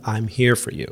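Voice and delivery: ordinary talk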